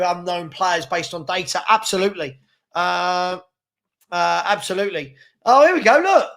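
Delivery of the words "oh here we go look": excited. Real, not acted. Greeting a chat regular.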